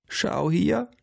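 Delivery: high-pitched grandma voice. A narrator's reading. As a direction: invite a look in a high, rising voice. (hohe Oma-Stimme)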